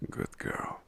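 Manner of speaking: soft whispered praise; hushed playful whisper. Acted. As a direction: whisper it as a teasing punishment. Whispered teasing punishment.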